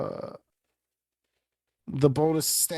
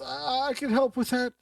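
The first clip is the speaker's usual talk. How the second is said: high, eager offer; helpful villain; 10 semitones higher than usual. Acted. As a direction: slip into his eager bargaining tone. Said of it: "he says".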